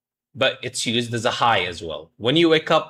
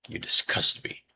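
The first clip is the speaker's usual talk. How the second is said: mock disgusted whisper; 6 dB quieter than usual. Mock disgust aimed at chat.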